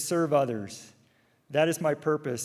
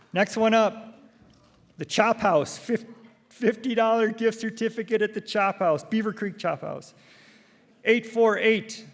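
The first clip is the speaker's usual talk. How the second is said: raffle caller; 4 semitones higher, slower than usual. Acted. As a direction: call the numbers slowly to the crowd. Calling raffle prize numbers.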